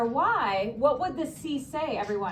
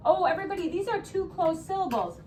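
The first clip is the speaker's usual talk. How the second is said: normal talking voice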